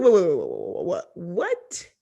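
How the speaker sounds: incredulous wait-what, then a shrieked who did that; shocked outrage. Retold or imitated. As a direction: play David hearing the news, stunned. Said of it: "he was like".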